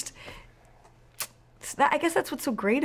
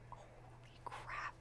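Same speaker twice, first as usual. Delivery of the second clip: breathless hushed whisper; awed whisper; 26 dB quieter, much slower than usual. Retold or imitated. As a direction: whisper it in pure awe. Awed at the music starting.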